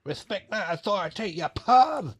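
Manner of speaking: high, loud cartoon kid yelling; Cartman impression. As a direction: shout like a bratty cartoon kid. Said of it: "It's Cartman".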